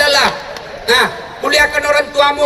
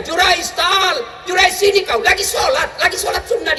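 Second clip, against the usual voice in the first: higher calling voice of a mother; 8 semitones higher than usual. Retold or imitated. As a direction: call the son from afar, higher. Mother calling her son. (ibu memanggil anaknya, suara tinggi)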